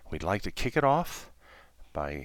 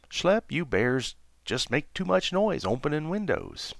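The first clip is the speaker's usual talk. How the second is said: monkey Louie; 6 semitones higher than usual. Acted. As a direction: tease the bears gently.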